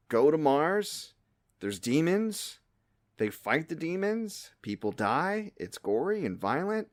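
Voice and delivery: ordinary talk, nothing marked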